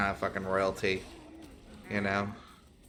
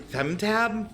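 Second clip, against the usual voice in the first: pitched-up baffled echo; high disbelieving question; incredulous; 9 semitones higher than usual. Retold or imitated. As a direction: repeat it like you can't believe it. Misreading gem tab, incredulous.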